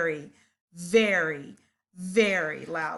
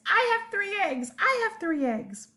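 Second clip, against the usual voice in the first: eager monkeys; 10 semitones higher than usual. Acted. Monkeys answering excitedly.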